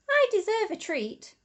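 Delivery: a hint of a badger rewarding himself; lightly voiced smug badger; Pete wanting a treat. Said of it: "he thought".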